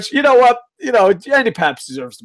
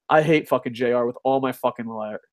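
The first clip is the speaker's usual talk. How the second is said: ordinary delivery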